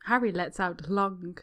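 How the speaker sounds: ordinary delivery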